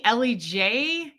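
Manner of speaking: ordinary talk, nothing marked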